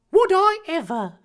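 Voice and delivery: delighted high, loud cry; thrilled put-on boy voice; Harry Potter. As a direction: burst out in hammed-up glee. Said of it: "Harry cried delightedly".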